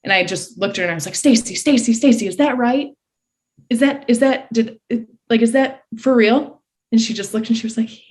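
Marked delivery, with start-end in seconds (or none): 1.2-6.6 high, stammering, urgent questioning; stunned disbelief. Retold or imitated. Swimmer to associate head coach after seeing the board, stunned. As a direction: beg for confirmation, barely believing it.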